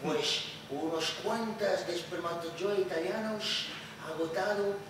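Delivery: low, muttering dad over the paper; father reading the news. Acted. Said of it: "Así como mi padre".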